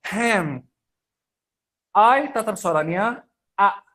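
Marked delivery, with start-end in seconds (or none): 0.0-0.6 "hand" with an open A as in cat; teacher's model "hand", English vowel. Shown as a demonstration. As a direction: say "hand" as haend. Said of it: "Nggak ada hand".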